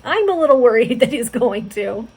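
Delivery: real laugh breaking into the words; laughing worry. Real, not acted. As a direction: admit a worry through loud laughter. Reader laughs nervously in aside.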